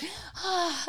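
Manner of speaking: high, airy mock sigh; imitated sigh. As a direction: copy the toddler's big relieved breath. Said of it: "she's walking around and".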